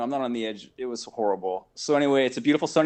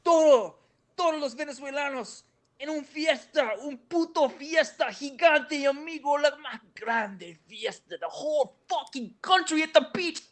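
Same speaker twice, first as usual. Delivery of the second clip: imitating Humberto, much higher and slower, half in Spanish; fired up; 13 semitones higher, slower than usual. Venezuelan guy raving to the host about the beach party, fired-up high voice. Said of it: "And he was fired up".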